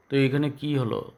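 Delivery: ordinary talk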